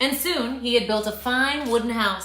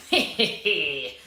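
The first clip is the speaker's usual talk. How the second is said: chuckling wolf; 6 semitones lower, slower than usual. Acted. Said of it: "He chuckled to himself".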